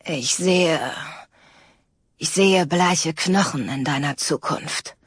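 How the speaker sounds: put-on a young woman faking a raspy old seer; mock-ominous crone voice, lightly put on; creaky, rough fortune teller. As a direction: rasp it out like a fake Hollywood fortune teller. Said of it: "spricht aber, um es zu kaschieren, knarzig und rau".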